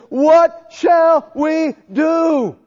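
high, slow, loud shout; desperate cry. Retold or imitated. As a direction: drag out the panicked plea. Crowd cries out to Peter.

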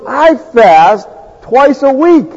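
drawn-out smug boast of fasting; slow boastful brag. Retold or imitated. Pharisee boasting to God, slow smug voice. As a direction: lean into the brag, slowly. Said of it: "he says".

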